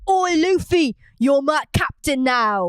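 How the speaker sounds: drawn-out mock British accent; British Sanji. Co-host echoing the Sanji impression, mock British accent. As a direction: join in with a hammed-up British "Oi". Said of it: "he's gonna have a British accent".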